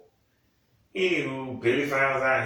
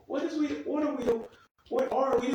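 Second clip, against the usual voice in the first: high, squeaky disbelief; baffled exasperation. Acted. Viewer questioning the show's plot, baffled and exasperated.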